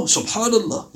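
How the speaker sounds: very soft, breathy-free murmur of wonder; hushed awe. Retold or imitated. Ibn Umar marvels after the visitors leave, hushed. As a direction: marvel quietly to yourself.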